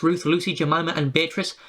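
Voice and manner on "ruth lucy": ordinary delivery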